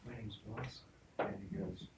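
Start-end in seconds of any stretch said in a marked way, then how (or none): 0.1-0.8 put-on soft, timid little girl voice; shy girl barely saying her name. Little girl telling the preacher her name, very soft. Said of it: "the little girl says".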